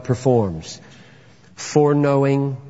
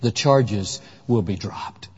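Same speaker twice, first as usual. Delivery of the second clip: slow, soft, low verdict of release; quiet judge's mercy; 7 semitones lower, 4 dB quieter, slower than usual. Retold or imitated. Preacher voicing the heavenly judge to the guilty defendant, slow and soft. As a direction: drop the charges gently. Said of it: "the judge says".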